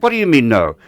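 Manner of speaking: retold exasperated outburst. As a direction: demand an answer, fed up. Exasperated at the refusal.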